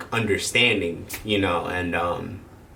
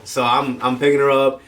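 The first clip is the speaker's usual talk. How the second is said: ordinary delivery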